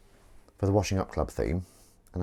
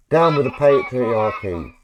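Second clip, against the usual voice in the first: slow, louder proclamation; slow slogan; 2 semitones higher, 6 dB louder, slower than usual. Acted. Testing the toy with a slogan, slow and proclaiming. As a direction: declaim it slowly like a rally cry.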